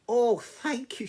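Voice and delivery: high, loud mouse bursting with thanks; grateful mouse. Acted. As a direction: squeak out your relief.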